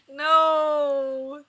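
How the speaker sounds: loud high anguished no; cringing wail. Real, not acted. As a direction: wail in embarrassment. Cringing cry at the text.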